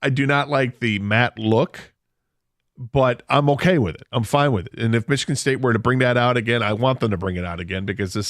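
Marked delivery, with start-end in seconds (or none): none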